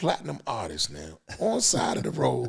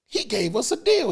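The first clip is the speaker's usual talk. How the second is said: normal talking voice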